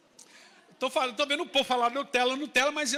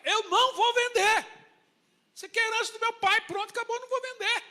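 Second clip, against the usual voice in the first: exasperated refusal; 11 semitones higher, 5 dB louder than usual. Retold or imitated. Naboth refuses king, heated quarrel.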